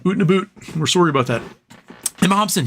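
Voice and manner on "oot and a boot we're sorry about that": jokey Canadian vowels, "oot" for out; playful put-on Canadian accent; mock Canadian accent. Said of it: "In Canada, it's very sorry".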